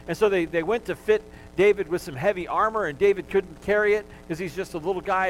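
ordinary talk

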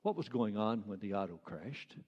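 low, hushed, hesitant question; timid question. Acted. Officer warily questioning a monkey, timid and quiet. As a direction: ask it timidly, barely daring to speak up. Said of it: "in desperation and somewhat timidly".

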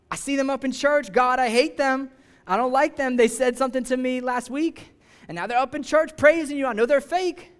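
venting a grudge against a churchgoer; indignant angry prayer. Acted. Angry believer complaining in prayer.

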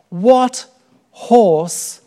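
"what ... horse" slowed and emphatic; stress on WHAT and HORSE. Real, not acted. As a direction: lean on "what" and "horse" as separate beats. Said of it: "stressed question words, pausing".

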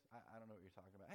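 low, hurried, soft mumbled denial; evasive denial. Retold or imitated. Peter denying Jesus to a stranger, mildly evasive. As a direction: brush the question off fast and quietly.

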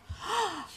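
shocked gasp. Retold or imitated.

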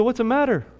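a gently voiced worried father; father asking. Father asks his crying son why, lightly voiced concern. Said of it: "He said".

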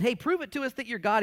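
high, taunting demand; mocking challenger. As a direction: goad him to prove it. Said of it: "One said".

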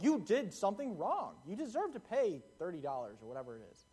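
ordinary delivery

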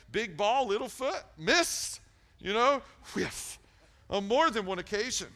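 high, loud, driving preacher voice; fired-up sermon peak; full-force preaching. Real, not acted.

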